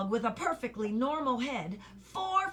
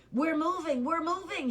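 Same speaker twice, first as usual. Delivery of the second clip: higher, delighted cry; happy shout; 6 semitones higher than usual. A narrator's reading. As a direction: shout it with joy. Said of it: "he shouted happily".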